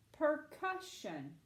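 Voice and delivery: PERCUSSION repeated slowly as one joined word; blended word. Shown as a demonstration. Teacher confirms the word for Abby, PERCUSSION slow and whole. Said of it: "let's try to say the word".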